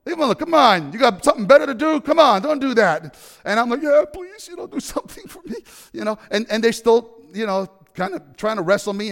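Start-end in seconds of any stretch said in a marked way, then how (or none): 0.0-3.2 acted yell to break up a fight. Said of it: "he starts yelling". 3.8-5.6 high-pitched mock-helpless plea; desperate pleading. Beaten boy begs rescuer for help.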